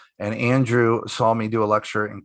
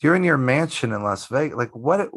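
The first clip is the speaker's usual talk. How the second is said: ordinary talk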